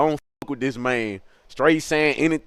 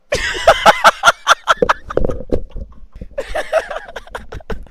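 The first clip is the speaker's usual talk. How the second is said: long high cackle; real laughing fit; 3 dB louder than usual. Real, not acted. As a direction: burst out laughing at your own tale. Cracks up at own question.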